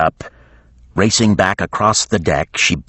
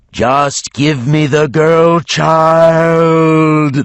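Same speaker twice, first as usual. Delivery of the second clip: very slow, higher, louder dragon demand; hard-breathing dragon; 10 semitones higher, 6 dB louder, much slower than usual. A narrator's reading. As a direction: breathe it out heavily like a beast. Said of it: "breathing so hard on the cabin doors".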